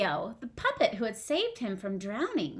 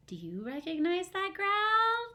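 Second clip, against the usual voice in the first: teasing question; 9 semitones higher than usual. Acted. Teacher teasing kids about growl.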